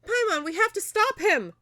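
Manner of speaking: high, loud acted alarm; urgent shout. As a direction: call out to a companion with urgency. Reads urgent game line aloud.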